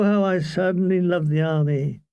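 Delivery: ordinary delivery, nothing marked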